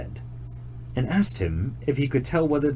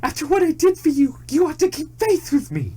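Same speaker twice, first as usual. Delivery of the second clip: frightened cry. A narrator's reading. Betrayed deer crying out to the tiger, high frightened plea. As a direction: cry out in terror and reproach. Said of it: "dreadfully frightened and cried".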